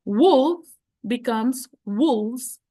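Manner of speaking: very slow, clear example read; slow teacher demo; slow model reading. Shown as a demonstration. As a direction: spell it out slowly for students. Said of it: "cambiar la F por V".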